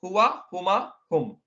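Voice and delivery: हुआ, हुमा, हुम drawn out slowly; slow teacher drill of हुआ हुमा हुम; slow recitation. Shown as a demonstration. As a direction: spell it out for learners. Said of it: "ये पहली line क्या है इसमें".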